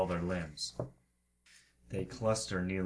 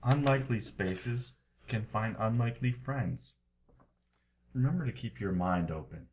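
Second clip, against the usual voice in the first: loud, portentous proclamation with long pauses; oracular book reading; 2 semitones higher, 4 dB louder than usual. Acted. Game master answers the Book of Alan consultation, oracular proclamation. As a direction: intone it like a wise old book.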